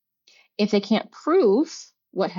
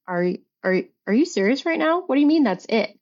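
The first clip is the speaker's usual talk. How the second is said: incredulous, higher-pitched challenge; retold disbelief; 5 semitones higher than usual. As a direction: throw the question back, voice jumping up in disbelief. Retold confrontation with her ex.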